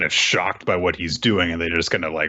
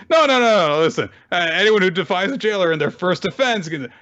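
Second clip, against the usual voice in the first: mock panicked protest; 9 semitones higher than usual. Jailer faces rebelling prisoners cracking their knuckles, mock panicked. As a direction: imitate a cornered man talking fast for his life.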